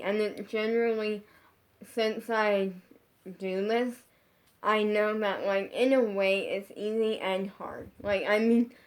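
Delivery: ordinary talk, nothing marked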